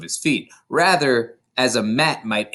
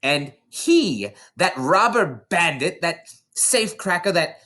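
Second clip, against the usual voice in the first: higher, ranting headmistress voice; tyrannical headmistress; 6 semitones higher than usual. A narrator's reading. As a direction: rage at the boy like a tyrant. Headmistress rants at accused boy.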